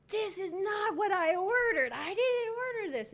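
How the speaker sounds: high, loud outraged customer; angry customer yell. Retold or imitated. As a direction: shriek it like an irate customer. Angry customer yelling at cashier.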